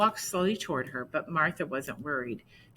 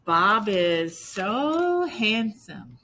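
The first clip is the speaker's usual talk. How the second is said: flattering; 5 semitones higher, 5 dB louder, much slower than usual. Acted. Martha flatters dog slowly.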